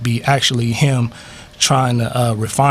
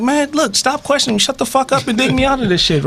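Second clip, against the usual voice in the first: high-pitched, impatient acted outburst; exasperated demand; 11 semitones higher than usual. Stuck bird telling off the questioning horse, exasperated demand.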